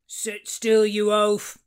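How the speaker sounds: loud, rough waterman's yell. A narrator's reading. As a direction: bark it across the boat. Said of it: "yelled the waterman".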